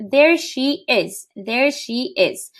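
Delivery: slow, careful model phrase; slow example. Shown as a demonstration. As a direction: spell the phrase out slowly for learners. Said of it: "The last example was".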